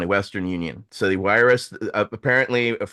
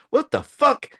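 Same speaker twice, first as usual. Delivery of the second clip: a high, baffled outburst; 13 semitones higher, 3 dB louder than usual. Retold or imitated. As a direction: spit it out in sudden confusion. Guards baffled by marijuana reason.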